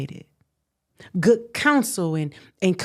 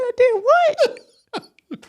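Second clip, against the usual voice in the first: high, slow stunned "I did what?"; retold disbelief; much slower than usual. Retelling her court shock, stunned disbelief. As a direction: draw it out in shock. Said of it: "I said".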